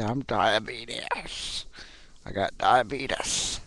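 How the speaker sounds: slow, drawn-out mock "diabeetus"; Wilford Brimley. Vlogger mocking an actor to doctors, slow drawling imitation. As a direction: stretch it out slowly, mocking an actor's pronunciation. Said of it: "if I was, uh, Wilford Brimley".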